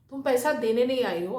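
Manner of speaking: ordinary delivery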